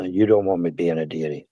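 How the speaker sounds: ordinary delivery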